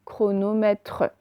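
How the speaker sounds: "Chronomètre" slowly, in separate syllables; slow syllabified "Chronomètre", final tre sounded. Shown as a demonstration. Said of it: "Faites bien syllabe par syllabe".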